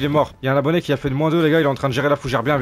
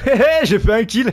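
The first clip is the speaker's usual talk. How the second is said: higher, louder cheer; gleeful crowing; 7 semitones higher, 5 dB louder than usual. Real, not acted. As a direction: crow about the win. Celebrates getting a kill. (cri de joie triomphant)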